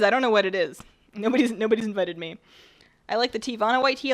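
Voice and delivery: usual speaking voice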